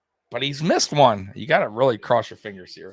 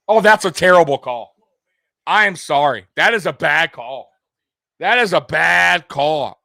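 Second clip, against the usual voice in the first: outraged; 4 semitones higher, 6 dB louder than usual. Real, not acted. Fan reacting to penalty call.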